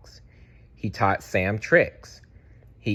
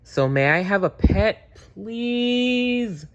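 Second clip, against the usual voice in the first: put-on high, rising little-boy beg; begging child; 12 semitones higher, slower than usual. A narrator's reading. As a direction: stretch out please like a child begging. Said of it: "he begged".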